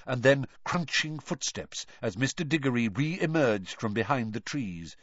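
ordinary delivery, nothing marked

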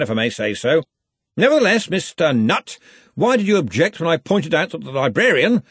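high, loud questioning of a player; brisk senior wizard. A narrator's reading.

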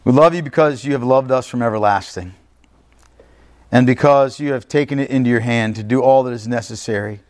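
earnest prayer with long pauses between phrases. Real, not acted. Preacher prays to the Lord for the people, prayerful.

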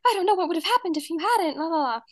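high, fast, gushing mom voice; hammed-up relieved mother; mock thankful mom, fast and high; grateful mom.

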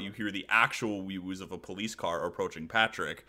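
usual speaking voice

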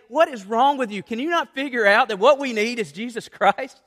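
a half-performed yell at the television; mock scream at the TV. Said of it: "I just want to scream at the T V".

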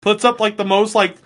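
ordinary talk

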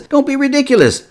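high-pitched, hammed-up protest; incredulous dismissal. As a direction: scoff as a king at a foolish volunteer. Acting King Saul dismissing David.

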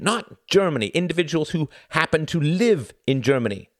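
high, exasperated correction; frustrated cry. A narrator's reading. Tom corrects Klaus about Germany, frustrated. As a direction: insist hard on each key word. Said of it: "cried Tom in frustration".

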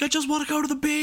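very high, fast mock wail; busted guy's wail. Retelling a busted man running out, high mock wail. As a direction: squeal it like a hammed-up excuse. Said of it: "He's like".

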